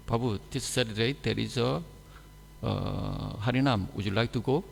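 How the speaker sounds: soft, courteous full question; polite full sentence. Shown as a demonstration. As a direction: ask gently, every word in place. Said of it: "So if you ask me".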